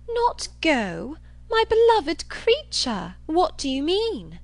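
high, drawn-out, gushing disbelief; theatrical dismay. Acted. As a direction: swoop up as if aghast. Isabella reacts aghast to refusal.

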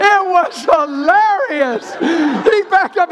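spoken through loud, high laughter; slow and loud, laughing the words out. Real, not acted. Still laughing as he tells the church about the cashier. Cracking up retelling the story.

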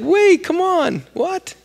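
very high, drawn-out protest; high-pitched, incredulous objection; mock disbelief. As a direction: plead in exaggerated shock. Acts manager protesting being fired.

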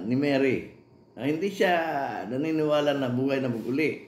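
usual speaking voice

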